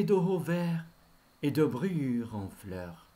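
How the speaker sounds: declaimed verse with sustained, measured phrases; poem recitation. Acted. Teacher reciting Hugo's poem to third-year pupils, declaimed verse. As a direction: declaim loftily, letting each stanza's last line fall away.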